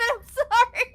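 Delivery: high, loud, helpless laughter; laughing apology. Real, not acted. Player apologising for laughing at the scene, laughing hard. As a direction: apologise through a burst of laughing.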